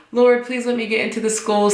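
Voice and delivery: retold eager prayer; praying. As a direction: beg God in a hurried little plea.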